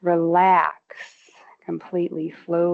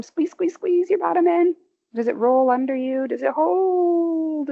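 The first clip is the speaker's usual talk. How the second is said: much higher, playful urging; coaxing squeeze; 9 semitones higher than usual. Real, not acted. Instructor urging listeners to squeeze their bottom, high coaxing. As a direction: coax the squeeze up and hold it.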